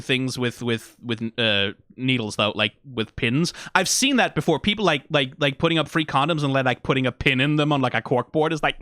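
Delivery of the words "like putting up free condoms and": ordinary talk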